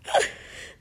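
short real laugh. Real, not acted. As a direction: burst briefly into a laugh. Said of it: "I'm laughing".

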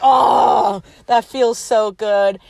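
loud, drawn-out mock sigh of relief; blissful relief. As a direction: let out a big slow "oh" of relief. Shoes off in relief, retold.

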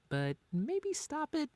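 pleading high and drawn out; exasperated. Acted. Scolding partner's jokes.

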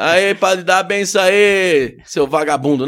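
loud, high mock greeting to a priest; rude street greeting. Fan hails Padre Marcelo, mock rude shout. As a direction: jokingly yell at the padre like a buddy. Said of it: "não vai falar desse jeito".

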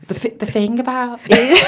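put-on slow, broad Birmingham accent; a hammed-up Birmingham accent. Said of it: "Go on, do your Birmingham accent".

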